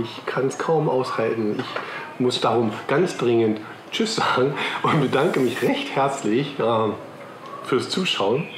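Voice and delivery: ordinary talk, nothing marked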